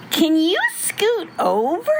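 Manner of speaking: high, drawn-out little pumpkin voice; high mild shout from a small character. A narrator's reading. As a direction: call out slowly in a high, pleading pitch. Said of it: "shouted the little pumpkin".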